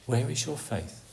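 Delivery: hushed, leaning on where; soft repeat. Shown as a demonstration. Preacher repeating the Lord's question quietly, inward. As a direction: let the question drop softly onto where.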